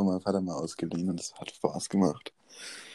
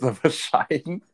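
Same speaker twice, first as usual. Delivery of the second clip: laughing; 5 semitones higher, slower than usual. Real, not acted. Amused disbelief at the joke.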